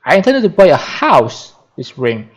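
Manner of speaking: emphasize "house" above all other words; stress on HOUSE. Shown as a demonstration. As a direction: hit the word "house" hard. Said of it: "to supplement the emphatic words".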